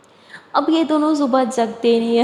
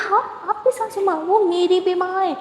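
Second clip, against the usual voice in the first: whiny teasing; 7 semitones higher than usual. Acted. Aliya teasing Maya about mother-in-law.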